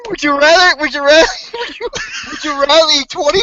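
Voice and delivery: words breaking into laughter; laughing, can't finish. Real, not acted. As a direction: try to speak through giggles. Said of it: "I can't even say it".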